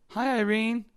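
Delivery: a high, cheery "Hi, Irene" to a coworker; high sing-song greeting. Shown as a demonstration. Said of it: "with that, at that tone".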